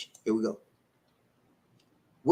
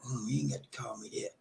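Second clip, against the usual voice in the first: whispered, under the breath; inner thought; 3 semitones lower, 13 dB quieter than usual. Retold or imitated. The lady's silent thought to her man, whispered. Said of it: "in her mind, it's like".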